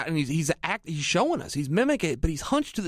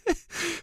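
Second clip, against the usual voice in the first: a quick burst of real laughter; short laugh; 10 semitones higher, 5 dB quieter than usual. Real, not acted. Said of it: "I'm now laughing".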